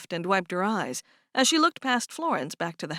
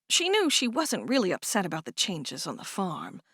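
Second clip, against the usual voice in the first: plain reading voice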